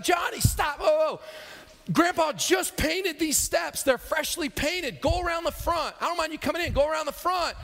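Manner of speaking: high, loud warning call; grandma yelling. Acted. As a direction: call out urgently to stop the kid. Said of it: "But she yells at him".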